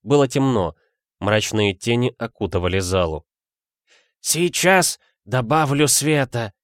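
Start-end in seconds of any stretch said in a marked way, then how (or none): 4.2-6.5 put-on muttering old man. A narrator's reading. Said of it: "пробормотал Ассолин".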